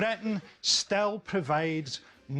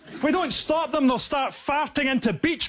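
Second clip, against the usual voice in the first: higher, earnest official barely holding it together; military spokesman voice; 9 semitones higher, 4 dB louder than usual. Pretend. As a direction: play a spokesman trying not to laugh. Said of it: "Israeli military spokesman must be keeping a straight face".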